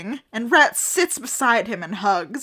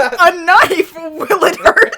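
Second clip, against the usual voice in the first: high, loud mock-dramatic outburst; hammed-up yelling; 6 semitones higher, 11 dB louder, slower than usual. Friend quotes a favorite fan fiction line, hammed-up shout. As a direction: shout it with silly melodrama.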